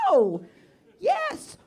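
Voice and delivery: very loud, flustered bursts; cornered yelling. Acted. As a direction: shout each word as a separate desperate burst. Acted cornered answers in an argument.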